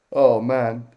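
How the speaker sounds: ordinary talk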